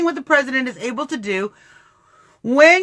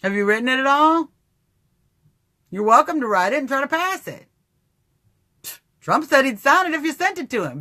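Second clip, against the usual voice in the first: usual speaking voice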